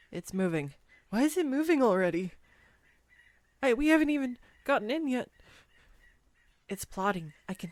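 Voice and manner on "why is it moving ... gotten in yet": fast, louder alarmed protest; real startled outcry. Real, not acted. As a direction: blurt it out as the boat drifts. Boat moves before they board.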